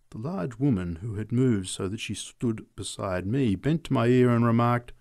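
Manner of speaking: usual speaking voice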